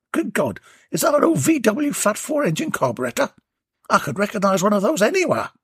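high, fast, amazed tradesman; excited workman spotting a carburettor; Warren excited. Acted. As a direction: rattle off his delight at the find. Warren excited about engine part.

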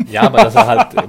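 high, loud laughing words; talking through a laugh. Real, not acted. Laughs while speaking.

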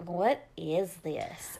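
mock suspicion of dinner; imitating balking child. Child balks at the dinner served.

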